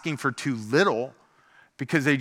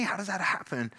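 ordinary talk, nothing marked

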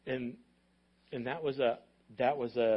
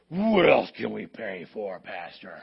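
lower, louder, slow, fervent ladies eager to pray; fired-up church ladies; 6 semitones lower, 6 dB louder, slower than usual. Retold or imitated. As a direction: mimic the ladies hungry for the next prayer. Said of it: "their nostrils were flaring".

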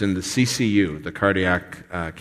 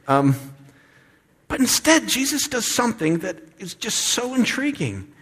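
normal talking voice

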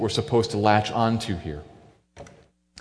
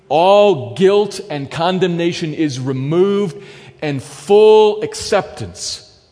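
rising fervent preaching; 5 semitones higher, 5 dB louder, much slower than usual. Real, not acted.

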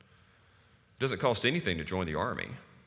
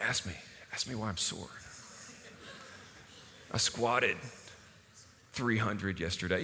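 mock bragging; 2 semitones lower, 5 dB quieter than usual. Young guy wanting to be asked about squats, mock bragging. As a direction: play it cool while fishing for admiration. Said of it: "brag about that".

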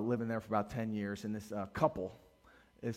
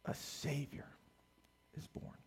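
whispered, much softer, hushed; 12 dB quieter than usual. Acted. Pastor echoing the angel to the church, hushed whisper. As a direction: breathe it like a secret of wonder.

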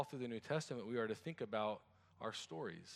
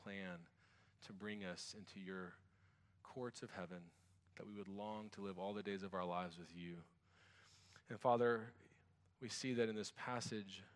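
softer, lower praying voice; prayer; 4 semitones lower, 6 dB quieter than usual. Real, not acted. Pastor leads the church in prayer, soft praying voice. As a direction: pray quietly with unhurried pauses.

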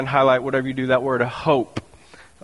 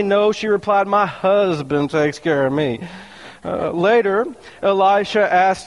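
higher, lifted reading voice; scripture reading; 6 semitones higher than usual. Acted. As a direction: read the Bible passage to the church. Reads scripture aloud.

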